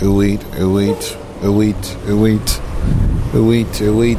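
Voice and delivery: sing-song ooh eat ooh like a bird; bird tweet imitation. Imitating the birds for the viewers in a sing-song chirp.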